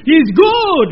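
loud and high "good"; emphatic shout. Shown as a demonstration. Preacher correcting himself, shouts "He's good". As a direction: declare "good" with full force. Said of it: "No, no, good".

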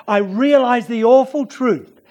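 ordinary talk

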